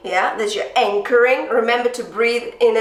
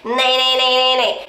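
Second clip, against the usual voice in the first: bright bratty nay; twangy nay drill; bratty twang; 2 semitones higher than usual. Shown as a demonstration. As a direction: cut through, bratty and bright. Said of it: "Really kind of bratty".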